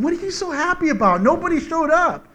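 high, fast irritated retort; annoyed usher. Retold or imitated. Angry usher confronting the happy old man, fed up. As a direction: snap at the whistling man. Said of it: "I said".

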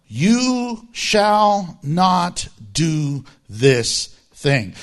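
very slow, word by word; solemn divine command. Acted. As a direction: deliver it as a commandment, each word separate. Quotes the Lord's command.

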